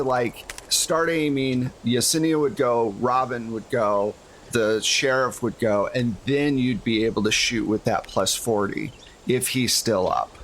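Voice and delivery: ordinary delivery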